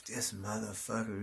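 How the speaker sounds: a muttered whisper of contempt under the breath; whispered curse. Retold or imitated. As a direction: curse him quietly so only you hear. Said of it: "And I'm like".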